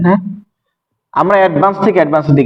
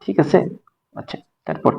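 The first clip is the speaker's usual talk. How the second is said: ordinary delivery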